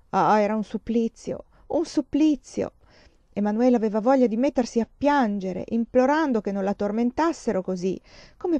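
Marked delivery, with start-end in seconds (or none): 0.1-2.7 anguished cry. Acted. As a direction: let the torment break through. Mother torn, anguished outburst.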